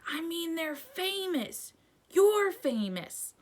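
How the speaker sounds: high, slow, astonished; amazed insistence. A narrator's reading. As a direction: stretch it out in wonder.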